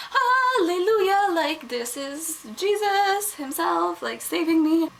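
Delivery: high, drawn-out hallelujah of relief; mock rejoicing. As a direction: celebrate like a miracle rescue, stretched out. Acting out exaggerated relief at silence.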